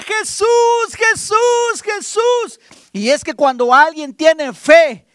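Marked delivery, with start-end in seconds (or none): 0.0-2.6 high, pleading cry of a blind man; blind beggar crying out. Acted. Blind Bartimeo begging Jesus for mercy, high pleading cry. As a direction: call out desperately for mercy. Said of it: "Bartimeo seguía".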